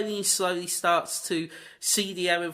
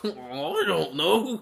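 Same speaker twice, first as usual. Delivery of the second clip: very slow, giggly cartoon dog; slow Scooby-Doo voice; 2 semitones higher, much slower than usual. Scooby answering the photo question, in a slow giggly cartoon voice. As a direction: drag it out like a puzzled Scooby.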